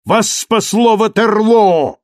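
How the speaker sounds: high, loud, contemptuous outburst; furious dismissal. Acted. Marius driving Thenardier out, furious and loud. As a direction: hurl the words at him in disgust.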